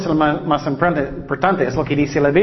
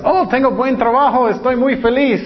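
cheery voice pitched higher; mock happy; 7 semitones higher than usual. Preacher mimicking a happy worker to the congregation, light mock cheer. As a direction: sing out the good news a bit.

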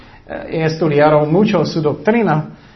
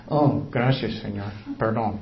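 sheepish thanks; 6 semitones lower, 8 dB quieter than usual. Retold or imitated. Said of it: "Pero después".

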